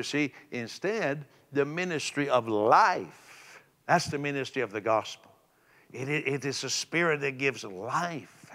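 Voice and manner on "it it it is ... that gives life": normal talking voice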